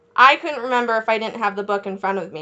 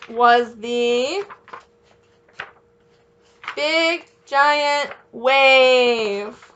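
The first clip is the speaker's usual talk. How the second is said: very slow, stretched big giant wave; slow dramatic reveal; drawn out; much slower than usual. A narrator's reading. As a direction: savor every word for the kids.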